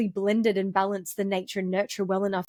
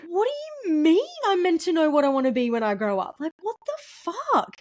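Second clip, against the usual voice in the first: retold teen disbelief; 8 semitones higher than usual. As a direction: play your younger self, baffled and overwhelmed. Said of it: "I remember sitting there like".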